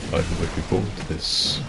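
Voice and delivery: quietly voiced villain impression; a restrained hint of a grand screen villain; Christopher Lee. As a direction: try the big actor's voice, kept quiet. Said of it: "it's hard to do quietly".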